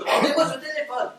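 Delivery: snatch the phone back; laughing but insistent; exasperated demand. Acted. Grabbing for the phone.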